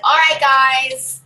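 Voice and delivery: loud, drawn-out group call; hearty, loud attention-getter; calling out to guests. Acted. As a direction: round up the room, stretching it out.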